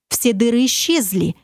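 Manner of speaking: ordinary talk, nothing marked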